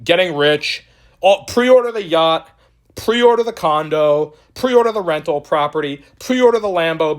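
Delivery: giddy send-up of hopeful holders; mocking dreamer. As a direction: ham up the yacht-and-Lambo dream. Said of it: "they didn't hear you on YouTube saying".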